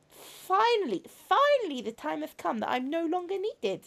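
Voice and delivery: softly earnest, sorrowful confession; robot girl confessing to her brother. Acted. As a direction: play a troubled robot girl, gently. Voices a robot girl in game.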